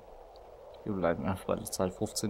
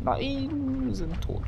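dismayed cry; much slower than usual. Real, not acted. Dies again.

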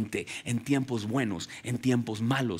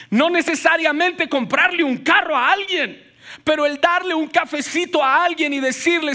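loud, high preaching build; preaching at full force; 12 semitones higher, 6 dB louder than usual. Acted. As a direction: preach it out to the congregation. (predicando con fuerza)

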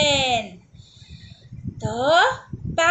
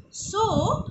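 ordinary delivery, nothing marked

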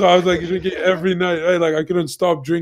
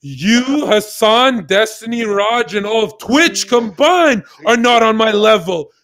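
slow, louder and higher, every name hammered; arrogant declaration; 5 semitones higher, 6 dB louder, slower than usual. Retold or imitated. To his girlfriend Jen, a slow loud higher arrogant proclamation. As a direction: lay it on thick, savouring each word.

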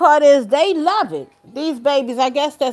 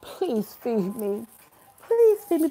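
voicing the baby; 6 dB quieter than usual. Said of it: "his little cry face be so serious, like".

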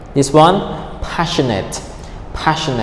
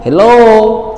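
hello swept sharply upward, louder; rising tone on hello; 9 semitones higher, 4 dB louder than usual. Shown as a demonstration. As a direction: say hello with the tone climbing high. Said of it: "If tone goes up".

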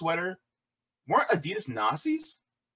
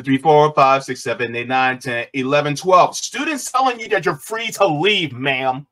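ordinary talk, nothing marked

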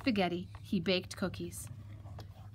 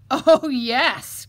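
loud, higher exclamation of delight; triumphant cry; 4 semitones higher, 12 dB louder than usual. Acted. Fox greets his surprise dinner, crying out. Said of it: "cried the fox".

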